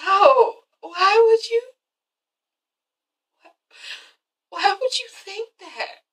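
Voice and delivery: high, fading, hurt voice; a touch of wounded denial. Retold or imitated. Cynthia answering her daughter, hurt high voice. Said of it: "And Cynthia's like".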